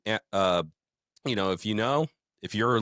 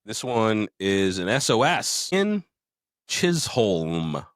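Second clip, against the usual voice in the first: mailbag announcement; 4 dB louder, slower than usual. Acted. Announcing the next mailbag letter.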